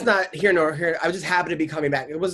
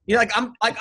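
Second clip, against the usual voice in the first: ordinary talk, nothing marked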